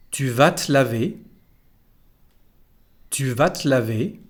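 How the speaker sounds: "Tu vas te laver" said tu vat-lavé; contracted te. Shown as a demonstration. Teacher modelling for repetition, "vas te" said as vat. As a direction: drop the E of "te", T onto "laver". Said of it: "We say something like".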